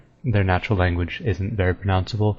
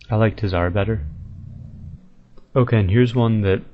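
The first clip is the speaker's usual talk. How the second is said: ordinary talk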